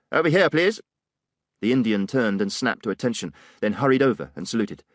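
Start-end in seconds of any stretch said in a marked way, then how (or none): 0.1-0.8 higher calling shout. Acted. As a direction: summon him across the alley. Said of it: "Digby shouted".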